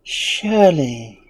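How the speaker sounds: very slow, drawn-out high answer; amused womanly voice. A narrator's reading. Unseen woman answering the wizard, amused and drawn out. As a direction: stretch it out in a womanly voice. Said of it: "repeating her low, rippling laughter".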